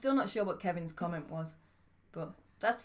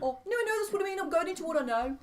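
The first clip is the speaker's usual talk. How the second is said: flustered protest; 9 semitones higher than usual. Real, not acted. Flustered about doing accents.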